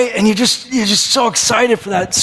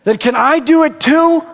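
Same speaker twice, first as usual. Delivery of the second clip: eager Peter; 6 semitones higher than usual. Acted. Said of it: "He's like".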